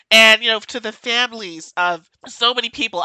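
ordinary delivery

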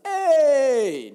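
high, loud, drawn-out "hey"; mock eager greeting. Visitor from Puerto Rico greeting Floridians, exuberant. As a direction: call out an over-friendly hello, high and loud.

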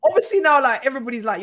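ordinary talk

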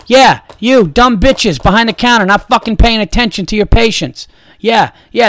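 loud, high, indignant yelling; sarcastic, fed-up calling out; angry outburst. Acted. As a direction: tear into inattentive staff. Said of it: "I'm getting angry".

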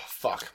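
breathy sigh into a quiet curse; pained sigh. Real, not acted. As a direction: exhale the pain out. Reacting to hot pepper.

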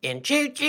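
high train whistle choo-choo; choo-choo call. Pretend.

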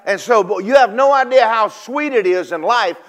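loud, high preacher's call, rising with fire; full force preaching. Acted. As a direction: preach it out to the congregation.

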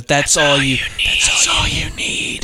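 drawn-out, rising come-on; acted seductive line. Movie nanny coming on to the married man, slow seductive tease. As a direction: let the line stretch out slowly, swooping up.

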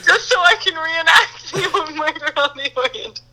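words squeezed through a high laugh; laughing at her own plan; laughing while talking. Real, not acted. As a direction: keep giggling as you explain. Laughing through murder fantasy.

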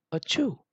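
high, short "achoo"; a small, high pretend sneeze; little mock sneeze. A narrator's reading. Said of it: "He blew out his little cheeks".

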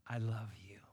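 whispered softly, low and close; tender whisper. Acted. Parent whispering love to muddy child, tender.

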